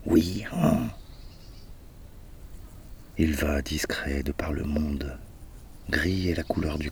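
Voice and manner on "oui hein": higher brayed hee-haw reply; donkey bray. A narrator's reading. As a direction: answer the litany like a donkey. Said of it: "plus fort que l'âne encore".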